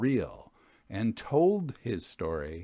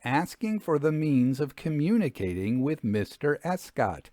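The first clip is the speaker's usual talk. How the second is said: measured storyteller reading a ghost tale; story narration; 3 semitones higher, 4 dB louder than usual. A narrator's reading. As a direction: read it like an old-fashioned narrator. Reads story narration aloud.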